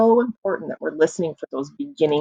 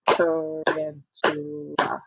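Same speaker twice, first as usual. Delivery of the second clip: softer, slow "Tarantula" in four beats; split into syllables; 5 dB quieter, much slower than usual. Shown as a demonstration. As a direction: softly clap out "Tarantula" syllable by syllable. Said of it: "Clap again".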